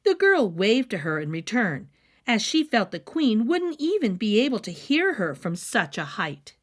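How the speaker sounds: ordinary delivery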